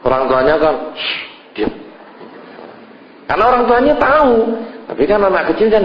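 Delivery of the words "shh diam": put-on breathy hissed hush to a child; a parent whispering shh, quiet. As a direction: shush the kid without a sound of voice. Parent hushing fussy child in mosque.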